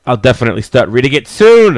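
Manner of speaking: loud, rising to a shouted last word; eager yell. Acted. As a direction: yell it out, far too keen. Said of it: "I didn't realize I was yelling".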